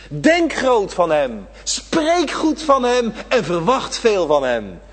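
loud, high, slow preacher's climb; full-force preaching. Acted. Preacher urging the congregation not to forget, at full force. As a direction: drive it home from the pulpit, stretching each word.

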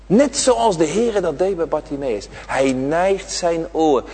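ordinary talk

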